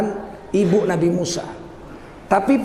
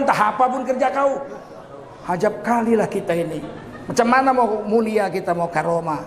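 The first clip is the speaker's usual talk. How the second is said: usual speaking voice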